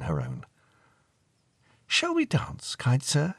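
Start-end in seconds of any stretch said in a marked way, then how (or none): 1.9-3.3 put-on very high, girlish invitation; little lady inviting a gentleman to dance; young girl asking. A narrator's reading. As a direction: ask politely in a high young voice. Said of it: "she asked".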